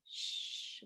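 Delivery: a soft unvoiced Shh like in a theater; shushing. Shown as a demonstration. Shushing someone talking in the theater, soft. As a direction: hush a talker in the theater. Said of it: "We all go".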